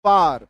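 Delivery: said alone, loud and clear; careful teacher's demo; demo word. Shown as a demonstration. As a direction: model the long a. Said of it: "आ के sound से बने हुए".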